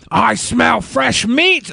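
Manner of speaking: slow high growly shark dude; shark mascot voice. Pretend. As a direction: play a goofy shark mascot. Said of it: "he was like".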